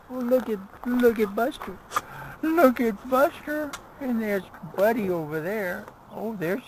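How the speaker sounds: high, loud dog-talk coo. Real, not acted. Vlogger cooing high at his dogs Buster and Buddy.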